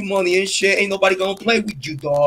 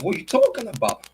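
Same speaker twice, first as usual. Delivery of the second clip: usual speaking voice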